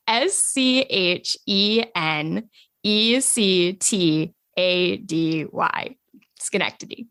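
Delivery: slow, letter-by-letter contestant delivery; spelling bee recital. Pretend. Guest spelling her town for the host, spelling bee recital. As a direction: spell it out slowly, then repeat the word. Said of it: "like it really was a spelling bee".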